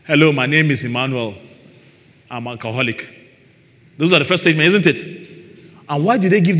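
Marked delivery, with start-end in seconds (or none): 0.0-3.1 mock AA introduction. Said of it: "you go for those A A courses".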